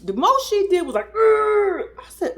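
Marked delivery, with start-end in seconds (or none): 1.1-1.9 high, loud mock growl; tiger growl. Woman imitating the girlfriend's reaction, tiger growl. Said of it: "Bitch, you a tiger now?".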